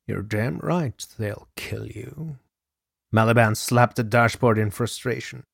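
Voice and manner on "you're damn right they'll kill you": soft, slow and cold; cold menace. A narrator's reading. Hitman coldly answering his begging partner, soft and slow.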